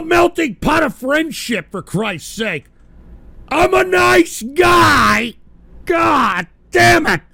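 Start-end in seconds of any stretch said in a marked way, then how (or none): 3.5-7.2 slow, higher, crying shout; half-crying exasperated yell; anguished outburst. Real, not acted. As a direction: wail it in frustration. Host breaking down after defending himself.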